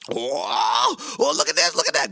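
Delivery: put-on excited kid. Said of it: "he was like".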